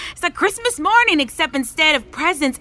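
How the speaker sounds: high-pitched, gleeful anticipation; excited. Acted. Schemer eager to start planning sabotage, excited. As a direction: pitch it up like Christmas morning.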